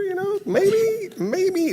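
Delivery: very high squeaky suggestion; mock tentative. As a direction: float it up in a tiny falsetto. Mock-timid suggestion of boundaries.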